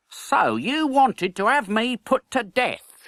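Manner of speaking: high, slow, mild menace; taunting boy. Acted. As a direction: savour the threat. Hugo confronts Edward.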